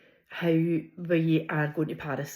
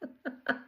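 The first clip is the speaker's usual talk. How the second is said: soft laugh; 8 semitones higher, 6 dB quieter than usual. Real, not acted. Laughing at own story.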